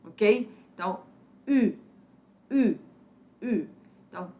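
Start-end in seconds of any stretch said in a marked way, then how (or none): 1.5-3.7 short, separate "U" with pouted lips; rounded French U. Shown as a demonstration. Teacher drilling French U for learners, each "U" short and rounded. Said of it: "é o formato arredondado da boca".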